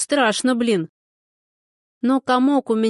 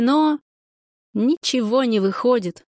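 plain reading voice

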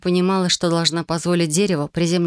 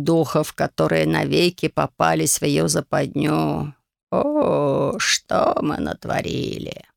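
put-on an old man crushed by guilt; remorseful old sage; slower than usual. Sage laments.

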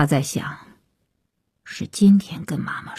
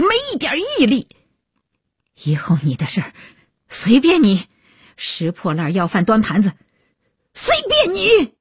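furious tirade, climbing to high-pitched outbursts; trembling with rage; 4 semitones higher than usual. A narrator's reading. Mother disowns failing daughter in front of everyone, enraged. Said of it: "妈妈气得声儿都哆嗦了".

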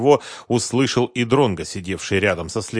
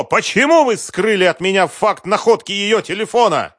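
high, loud sustained tirade; furious shouting; 10 semitones higher, 5 dB louder than usual. Acted. Businessman berating the official investigator, furious shout. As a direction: keep hammering him in rage. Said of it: "закричал Сергей Викторович".

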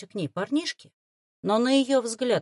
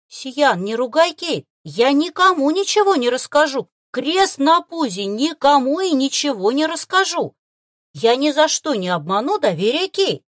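high, loud fervent promise; earnest reassurance; 6 semitones higher, 8 dB louder than usual. Acted. Boy defends the girl to the fox, fervent. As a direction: beg the fox to believe him. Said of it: "заверил паренёк".

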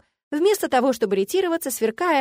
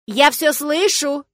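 loud, slow, drawn-out warning; outraged, stretched-out shout; indignant; 5 semitones higher, 7 dB louder, much slower than usual. A narrator's reading. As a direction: call it out slowly with indignation. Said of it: "возмутилась я".

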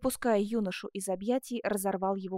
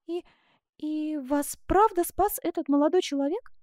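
high, hesitant question; timid, halting start; faltering; 6 semitones higher, 4 dB louder than usual. A narrator's reading. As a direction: stumble on the first word, then ask. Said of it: "запинаясь".

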